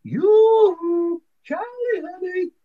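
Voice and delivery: very high, slow sing-song call; a mermaid calling sweetly to Charlie; tinkly sweet voice. A narrator's reading. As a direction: coo like a pretty little mermaid. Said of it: "the same sweet voice says".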